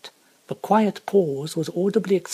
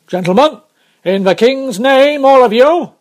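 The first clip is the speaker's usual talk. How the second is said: loud, climbing commanding shout; guard summoning every passenger to arms; urgent adjuration; 8 semitones higher, 13 dB louder than usual. A narrator's reading. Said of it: "With this hurried adjuration".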